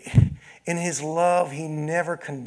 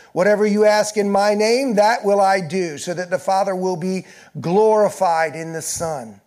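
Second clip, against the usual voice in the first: loud, high, urgent pulpit voice; full-force preaching; 6 semitones higher, 8 dB louder than usual. Acted. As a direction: preach it out to the whole room. Preaching and reading scripture loudly.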